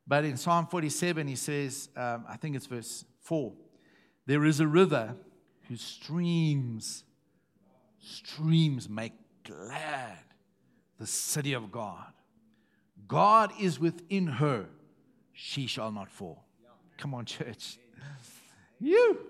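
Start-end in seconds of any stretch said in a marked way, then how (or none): 4.2-16.4 slow, weighty reading of the psalm; scripture reading. Acted. Preacher reading the psalm to his church, slow and weighty. 18.8-19.2 sudden high shout; calling out. Real, not acted. Preacher calling out to his congregation, a sudden high shout.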